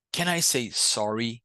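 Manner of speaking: normal talking voice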